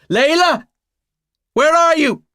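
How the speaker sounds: loud high call down the corridor. Acted. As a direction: shout for her through the house. Calling through the house.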